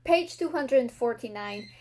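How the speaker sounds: usual speaking voice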